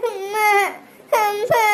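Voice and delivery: small whimpered call for mother; crying. Acted. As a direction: whimper tearfully, calling out for mum. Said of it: "ஏன் அழுகுறடா?". (அழுதுகொண்டே அம்மாவை அழைத்தல்)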